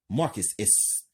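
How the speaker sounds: ordinary delivery, nothing marked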